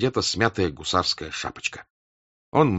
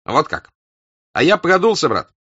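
loud, high furious yell; angry shout; 10 semitones higher, 7 dB louder than usual. A narrator's reading. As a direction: shout out his bad luck. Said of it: "закричал Денисов".

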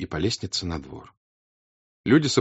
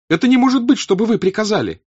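very high, loud, outraged cry; Natasha shouting; 9 dB louder than usual. Acted. Natasha bursting in on her mother, shouting. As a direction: hurl the words, outraged. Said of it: "закричала она".

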